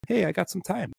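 high, breezy imitation of a carefree customer; sing-song put-on client voice; mock customer voice. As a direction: play the customer who thinks he has all the time. Mocking a relaxed customer.

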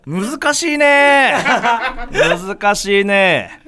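very slow, loud drawn-out complaint; comic lament. Pretend. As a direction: stretch it out in mock despair. Drawn-out comic lament over weight. (大げさに伸ばして嘆く)

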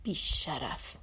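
low, soft muttered insult; a quiet, bitter curse; under her breath. A narrator's reading. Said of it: "زیر لب گفت".

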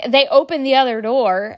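normal talking voice

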